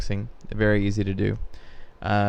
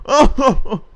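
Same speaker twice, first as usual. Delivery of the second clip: high laughing oh; 14 semitones higher, 6 dB louder than usual. Retold or imitated. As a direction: let the realization come through a laugh. Tourists get the joke.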